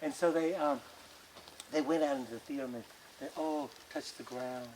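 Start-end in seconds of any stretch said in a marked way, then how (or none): none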